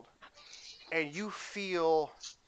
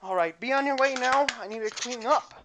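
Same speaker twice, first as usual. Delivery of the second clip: brisk dismissal; 8 semitones higher, 5 dB louder than usual. Acted. Shooing the customer out.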